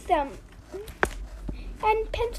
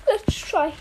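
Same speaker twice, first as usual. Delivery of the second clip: ordinary delivery, nothing marked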